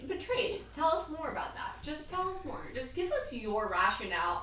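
mock therapist.

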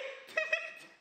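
high burst of laughter. Real, not acted. Laughing at the story.

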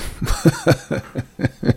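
quiet, drawn-out laugh. Real, not acted. Streamer cracking up at his own joke, quiet laugh. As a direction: laugh softly to yourself.

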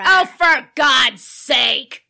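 loud, slow, fed-up outburst; exasperated shout. Acted. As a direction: bellow it in frustration. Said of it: "Stop yelling".

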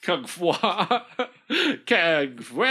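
ordinary delivery

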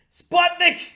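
high, loud, drawn-out call; mock bellow. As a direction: holler the nickname in character. Calls out mock nickname in character.

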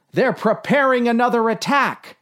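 high-pitched cry of alarm; exclaimed. A narrator's reading. Dave alerting the captain to another attack, exclaimed. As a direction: sound the alarm, pitch jumping up. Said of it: "exclaimed Dave".

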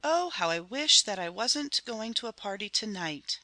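mildly anxious woman's voice; understated worried wish; fearful cry. A narrator's reading. Mother uneasy about leaving her children, mildly fearful. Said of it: "nameless fear clutched at her heart".

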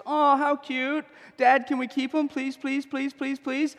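Pharaoh's daughter sweetly begging her father; pleading little girl. Retold or imitated. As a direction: beg Dad like a kid who wants to keep a puppy. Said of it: "and she goes".

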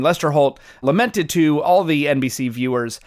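ordinary talk

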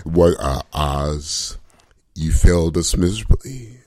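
deep, drawn-out mock of the co-host; a mild, lightly voiced send-up of a smug daddy; mocking impression. Friend mocking the host scolding Oz, low mock voice. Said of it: "just like saying".

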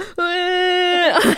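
high, frantic creature squawk; panicked dinosaur noise. Pretend. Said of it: "I will recreate that".

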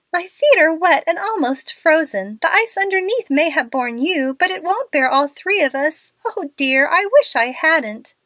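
high, tearful pleading; a frightened girl, lightly sobbing as she begs. A narrator's reading. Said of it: "sobbed Lottie".

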